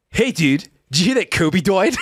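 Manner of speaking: coworker's cartoonish baby-talk voice; imitated speech impediment. Coworker breaking the news of a death, imitated speech impediment. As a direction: blurt it with rounded, babyish consonants. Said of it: "he says the fateful words".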